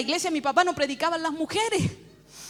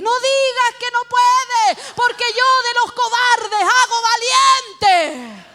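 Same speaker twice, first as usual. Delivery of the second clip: high, loud, slow proclamation; thundering rebuke; 8 semitones higher, 9 dB louder, slower than usual. Retold or imitated. Woman declaring God's answer to her, thundering rebuke. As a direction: preach it at full force.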